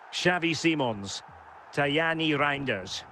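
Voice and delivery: normal talking voice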